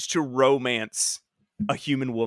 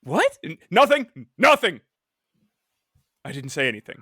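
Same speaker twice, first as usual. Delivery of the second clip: startled wife; 4 dB louder than usual. Retold or imitated. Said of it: "Marjorie's like".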